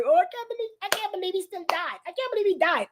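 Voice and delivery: hammed-up weepy disbelief; mock crying. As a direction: imitate blubbering over a movie death. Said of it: "you start crying".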